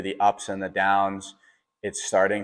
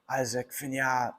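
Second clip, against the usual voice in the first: higher, softer mock announcer call; imitated race announcer; 5 semitones higher, 6 dB quieter than usual. Announcer calling runner at the finish, imitated announcer call. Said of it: "they start to announce your name".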